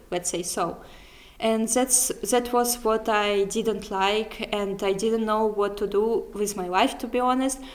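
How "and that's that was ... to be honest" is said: usual speaking voice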